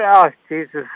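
usual speaking voice

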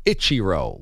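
"Ichiro" said as ITCHY-roh; itchy-style mispronunciation. Retold or imitated. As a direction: start "Ichiro" like the word itchy. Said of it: "like it's itchy and scratchy".

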